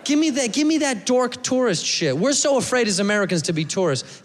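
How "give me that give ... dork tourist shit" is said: ordinary talk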